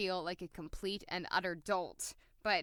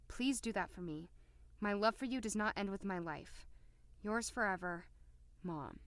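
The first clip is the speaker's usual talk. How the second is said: letter reading; 4 dB quieter than usual. Acted. Aaron reading his mother's farewell letter, soft and hushed. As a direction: read a dead mother's words gently.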